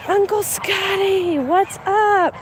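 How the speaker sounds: high, slow sing-song for a pet; cooing pet talk. Real, not acted. Greeting the animal in baby talk.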